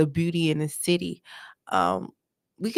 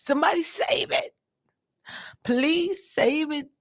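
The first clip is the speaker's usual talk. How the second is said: mock plea; 7 semitones higher than usual. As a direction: beg dramatically. Pleading for the manatee.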